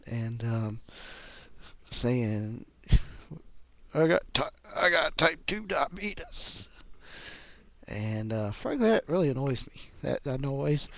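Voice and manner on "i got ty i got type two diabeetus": Wilford Brimley. Acted. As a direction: stretch it out in a pitched-up mock actor voice. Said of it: "I think Wilford Brimley is a little evil".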